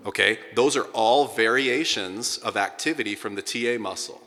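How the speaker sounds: ordinary talk, nothing marked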